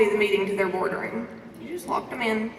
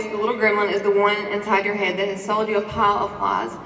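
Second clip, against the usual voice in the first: ordinary talk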